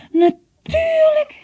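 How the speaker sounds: loud, high, drawn-out cry of realisation; excited exclamation. Acted. Jon slapping his knee as he remembers, exclaiming loudly. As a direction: stretch it out as it suddenly dawns on you. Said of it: "riep hij uit en hij sloeg zich op de knie".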